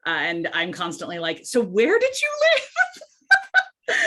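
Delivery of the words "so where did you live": high, slow, sing-song question; playful high question. Retold or imitated. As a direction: draw it out teasingly. Quizzing people on accents.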